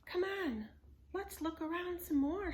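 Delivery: put-on high, eager little girl voice. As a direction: urge him on like a kid.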